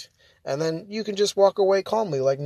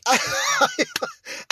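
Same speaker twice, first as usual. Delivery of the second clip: high laugh. Real, not acted. Vlogger laughing at a Karen's empty threat, high laugh. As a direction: burst of high squealing laughter.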